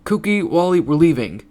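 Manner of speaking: projected voice, starting high; calling out. A narrator's reading. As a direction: call them over firmly. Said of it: "called to the other two".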